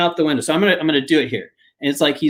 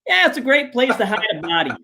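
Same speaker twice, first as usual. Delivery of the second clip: quick, higher, casual blurt; deadpan blurt; 7 semitones higher, faster than usual. Retold or imitated. Mike answering the reporter, quick deadpan blurt. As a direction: toss it off without thinking.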